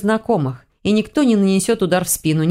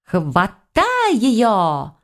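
high, long-drawn chase call; pursuers' cries; 5 semitones higher, much slower than usual. A narrator's reading. Pack of girls chasing her from behind, drawn-out calling. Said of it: "донеслось в спину".

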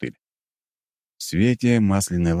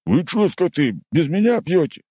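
indignant; 12 semitones higher, 4 dB louder than usual. Acted. Sergeant catches drinkers.

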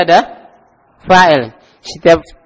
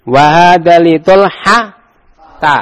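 slow, drawn-out Arabic recitation; slow teacher demo; slow model reading; 3 dB louder, much slower than usual. Shown as a demonstration. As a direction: recite slowly for the class to repeat.

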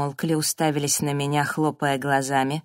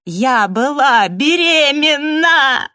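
very high, loud, drawn-out cry; anguished shriek, forcing the words out; choked scream; 10 semitones higher, 9 dB louder, much slower than usual. A narrator's reading. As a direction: scream out a painful confession. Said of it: "я закричала, давясь словами".

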